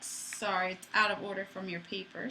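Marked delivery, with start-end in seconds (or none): none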